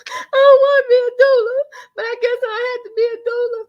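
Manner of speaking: high, fast mock bawling; hammed-up tearful whine, very high-pitched; crying like a baby. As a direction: wail it like a little kid in tears. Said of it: "I was crying like a little baby".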